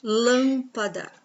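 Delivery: "Lâmpada" slowly, LÂM-pa-da; stressed first syllable. Shown as a demonstration. Teacher showing the antepenultimate syllable, "Lâmpada" slow with LÂM drawn out. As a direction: hold the LÂM in "Lâmpada", then "pada". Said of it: "e lã a antepenúltima".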